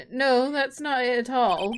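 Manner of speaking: normal talking voice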